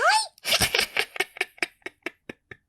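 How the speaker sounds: high, mocking vulture cackle. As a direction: cackle at her like a rude bird. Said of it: "They're cackling away at her".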